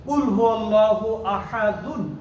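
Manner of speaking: slow, melodic Quran recitation; slow recited Arabic, no pause before আহাদুন. Shown as a demonstration. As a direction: recite the verse slowly with tajweed, running through to the end. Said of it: "যেমন".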